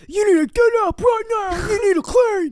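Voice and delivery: high, loud mocking nag; grumpy partner impression. Mocking partner's morning orders, high and loud. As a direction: imitate him barking orders in a squawky high voice. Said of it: "he woke up in a shit mood".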